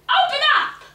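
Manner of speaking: high, very loud shout; the witch commanding the mask. A narrator's reading. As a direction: yell the order at the cupboard. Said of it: "Then she shouted".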